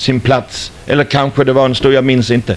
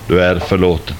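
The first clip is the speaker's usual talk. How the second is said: much lower, calm and gentle; voice of God; 7 semitones lower than usual. Retold or imitated. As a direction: speak it as God, deep and forgiving. Voice of God repeated. (Guds röst, låg och mild)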